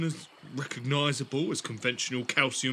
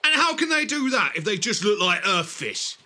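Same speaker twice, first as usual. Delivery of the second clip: louder, disbelieving questions; incredulous; 6 semitones higher, 7 dB louder than usual. Acted. Student doubting the Doctor about air-swimming fish, loud disbelief. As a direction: push back in astonished disbelief.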